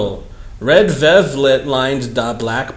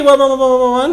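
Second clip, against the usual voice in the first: mock shrill ones; 10 semitones higher, much faster than usual. Mocking the fanfic's exclamation marks.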